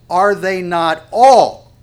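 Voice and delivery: all loud, high and drawn out; slow, forceful line landing hard on all; stress on all. Shown as a demonstration. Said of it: "I want to emphasize all".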